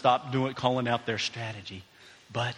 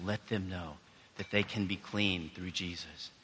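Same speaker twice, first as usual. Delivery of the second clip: soft, low prayer with long pauses; 4 semitones lower, 5 dB quieter than usual. Real, not acted. Pastor leading the congregation in prayer, soft praying. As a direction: pray gently, lower and hushed.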